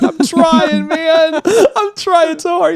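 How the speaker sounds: real laughter through the words; laughing. Real, not acted. Laughing while answering him.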